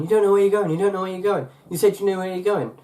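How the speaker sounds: high-pitched, insistent imitation of fretting; mock panic. Imitating friend who keeps doubting the route, mock panicky. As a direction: keep on at them in mock alarm. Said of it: "she was panicking".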